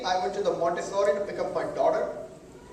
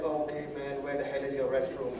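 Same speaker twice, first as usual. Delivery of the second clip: low muttered grumble, much softer; muttered under breath; 8 semitones lower, 7 dB quieter than usual. Retold or imitated. Fed up with the clerk, grumbling under his breath. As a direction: curse quietly to yourself. Inner frustrated thought.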